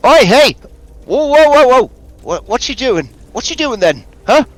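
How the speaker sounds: put-on high, loud old woman voice barking a threat; startled old crone warning strangers off; old woman challenging the party at their campfire, high and loud; menacing old woman. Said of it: "threatens you with it, uh, menacingly".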